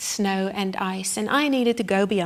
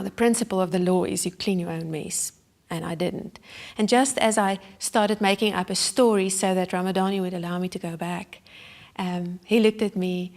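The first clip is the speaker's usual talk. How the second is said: normal talking voice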